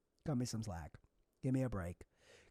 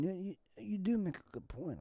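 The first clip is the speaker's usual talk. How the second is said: usual speaking voice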